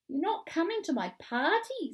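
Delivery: high, incredulous protest; upset child's high-voiced objection; dismayed disbelief. A narrator's reading. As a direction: protest in disbelief, pitch lifted. Jack learns friend won't come.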